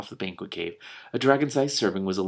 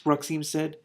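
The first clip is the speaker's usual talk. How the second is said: usual speaking voice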